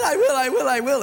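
high, loud frantic promise; eager boy. Acted. Boy promises sister anything, frantic and high. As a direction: blurt it fast and eager.

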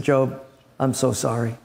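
low, heavy sorrowful voice; somber messenger. Acted. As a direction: break the bad news gently and heavily. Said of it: "comes in somber, looking very, very down".

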